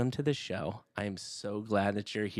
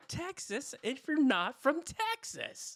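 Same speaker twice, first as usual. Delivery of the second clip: mock outrage; 4 dB louder than usual. Real, not acted. Outraged at Texas Pete hot sauce.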